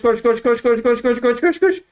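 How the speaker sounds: rising frantic chant of run; frantic urging. Real, not acted. Gamer chanting run at himself, rising.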